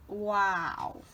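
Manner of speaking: usual speaking voice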